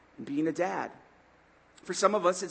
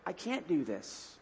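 slow, much softer, deflated; defeated; 3 semitones lower, 9 dB quieter, slower than usual. Retold or imitated. Churchgoer the next morning, deflated and soft. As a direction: let the air out of it. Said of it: "the next morning you're like".

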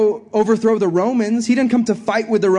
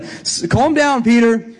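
Jesus calming Peter. Retold or imitated. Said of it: "He's like".

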